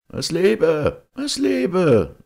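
high, loud call like many voices; echoing crowd cheer. A narrator's reading. Many voices answer from the cave depths, cheering loudly. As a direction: shout it far up high, drawn like an echo. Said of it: "das Geräusch vieler Stimmen".

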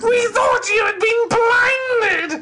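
loud, very high surprised cry; high shout. Acted. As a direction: call out in shock.

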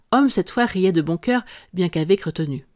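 usual speaking voice

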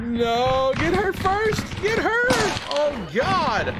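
high, panicky pleading; panicking gamer. Real, not acted. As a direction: shriek as the monster closes in. Chased by monster, panicking.

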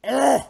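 short screamed ugh; hammed-up high fight cry; high loud grunt. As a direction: yelp out a harsh grunt. Acting out fight cry in game.

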